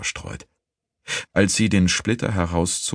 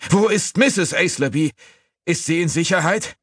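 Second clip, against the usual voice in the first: high, urgent raised voice; frantic questioning; 14 semitones higher, 3 dB louder than usual. A narrator's reading. Master asking servant about his wife, high and frantic. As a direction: demand news in alarm.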